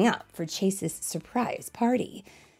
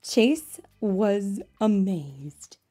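ordinary delivery, nothing marked

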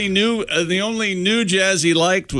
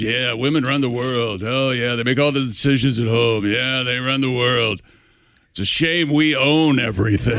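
Tim Allen impression; 8 semitones lower than usual. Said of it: "He goes".

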